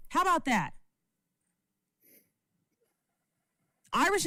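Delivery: high, sharp tell-off to one viewer; angry yelling. Real, not acted. Streamer yelling at Debbie to shut up. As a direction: cut her down and keep going. Snapping at a heckler in chat.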